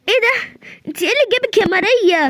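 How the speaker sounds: high startled question at the door; surprised greeting. Acted. Host surprised to find Maria at the door. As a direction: jump up in surprise at the visitor.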